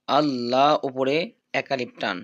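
ordinary delivery

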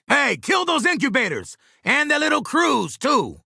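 high-pitched battle call to the squad; shouted combat order, pitched high. Acted. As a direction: call the order out over gunfire.

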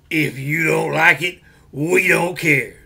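loud slow wrestler promo; Road Warriors growl. Retold or imitated. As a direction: bellow it like a pro wrestler. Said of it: "as the Road Warriors used to say".

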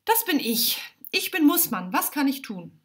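high-pitched, obliging goblin voice; high character voice of a small goblin; little round goblin. A narrator's reading. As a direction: introduce yourself high and eager to serve. Round goblin Mussmann introduces himself.

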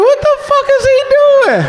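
very high, laughing disbelief; incredulous squeal. Retold or imitated. Cousins reacting at party.

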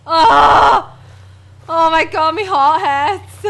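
shaken after fright. Real, not acted.

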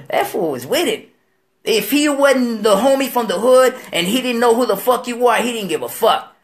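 usual speaking voice

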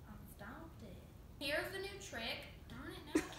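ordinary delivery

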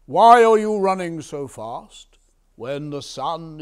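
loud, swooping royal question; commanding king. Acted. Old king questions the running farmer, loud command. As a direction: call it out with loud authority.

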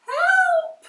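high, loud scream of help; panicked shout; cry for help. A narrator's reading. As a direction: call for rescue in terror. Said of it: "cried the witch".

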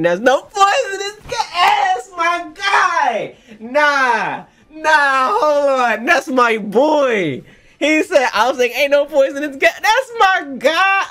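loud, high cheer with stretched-out shouts; euphoric screaming. Real, not acted. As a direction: holler with pride for your hero. Screaming praise of character's line.

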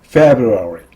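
"February" with F released from top teeth on lip; strong FEB at the start of "February". Shown as a demonstration. Teacher modelling February for repetition, crisp lip-bitten F. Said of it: "F는 윗니로 입술을 물었다가 떼면서".

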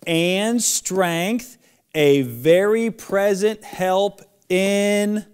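slow and loud, every word drawn out; teacher calling out a memory verse for kids to repeat; slow lead-along recitation. Shown as a demonstration. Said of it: "I'm sure you got it".

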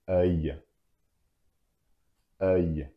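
"Œil" as an open eu ending in a y glide; French pronunciation. Shown as a demonstration. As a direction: model "Œil" for learners, eu plus y. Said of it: "you finish with the sound y".

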